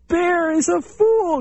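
very high, gleeful taunting chipmunk voice; the chipmunk jeering at the bear; mocking chipmunk. A narrator's reading. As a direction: crow over the foolish bear. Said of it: "Chipmunk began to laugh".

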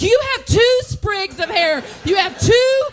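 high-pitched, hammed-up rage, slowing to stretched-out words; furious comic rant. Comedian recalls threatening the balding landlady, high enraged rant. Acted quarrel with insulting landlady.

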